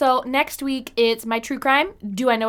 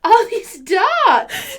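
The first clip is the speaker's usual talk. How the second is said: high, slow laughing squeal; 9 semitones higher, 4 dB louder, much slower than usual. Real, not acted. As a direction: squeal the words through laughter.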